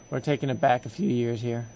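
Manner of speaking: scratchy voice. Real, not acted. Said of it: "my throat is not yet used to singing".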